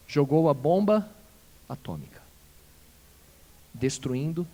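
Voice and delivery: ordinary delivery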